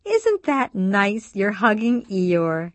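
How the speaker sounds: put-on high, slow, loud baby-talk. A narrator's reading. Ginny greets the baby hugging his toy, in a high voice. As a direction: coo it high and drawn out to a toddler. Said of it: "says in a high voice".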